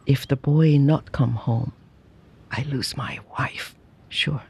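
lightly voiced bitter sorrow, fading away; bitter sadness. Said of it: "with bitter sadness".

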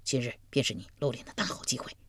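soft, low confidential murmur; hushed aside. A narrator's reading. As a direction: speak low so only he hears. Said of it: "小声说". (压低声音悄悄说)

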